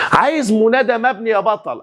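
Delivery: normal talking voice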